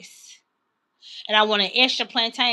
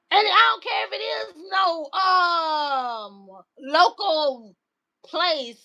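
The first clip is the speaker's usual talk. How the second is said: indignant outburst; 5 semitones higher, slower than usual. Real, not acted. Outraged telling of restaurant refusal.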